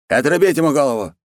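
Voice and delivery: high, loud command; furious order. Acted. Khan orders the messenger beheaded, high loud command. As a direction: call out the execution order.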